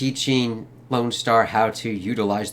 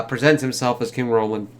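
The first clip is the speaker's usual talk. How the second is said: usual speaking voice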